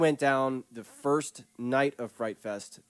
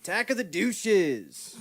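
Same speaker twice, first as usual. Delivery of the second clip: announcer voice; 10 semitones higher, 7 dB louder, slower than usual. Acted. Dramatic title announcement.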